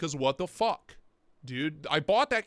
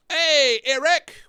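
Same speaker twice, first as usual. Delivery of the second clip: calling out; 8 semitones higher, 10 dB louder, much slower than usual. Real, not acted. Calling out greeting to viewer.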